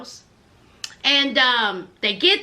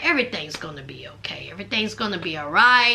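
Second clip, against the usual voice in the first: low, hushed, quick reassurance; consoling; 9 semitones lower, 3 dB quieter, much faster than usual. Retold or imitated. Stepdad comforting Q through her breakdown, softly consoling. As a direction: soothe her in a soft near-whisper. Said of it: "he was consoling her".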